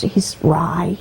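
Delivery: usual speaking voice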